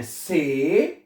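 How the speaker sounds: से with a long "ay"; German C as "say". Shown as a demonstration. Teacher tells students how C is read, "say". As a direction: say से slowly, not "see". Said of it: "सी को आपने पढ़ना है".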